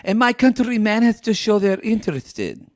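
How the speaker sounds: high mock Ukrainian accent; Natalie. Co-host imitating a demanding date, high Ukrainian accent. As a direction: lay down the rules in a high Ukrainian voice. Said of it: "I am Ukrainian girl".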